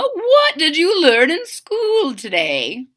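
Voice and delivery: slow, high doting mother; Mama's voice. Acted. Mama asks her son what he learned, slow high mother. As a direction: ask with bright interest.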